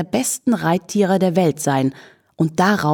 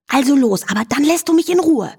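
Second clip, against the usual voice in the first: high, quick, grudging consent; exasperated demand; 9 semitones higher, faster than usual. A narrator's reading. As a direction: give in crossly, pitch climbing. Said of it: "verlangte Charlotte".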